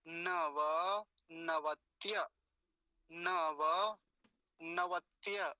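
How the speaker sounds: very slow, split into pieces; long drawn-out demonstration; broken-down model. Shown as a demonstration. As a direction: stretch every syllable for learners. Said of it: "ऐसा करेंगे तो आसान हो जाएगा".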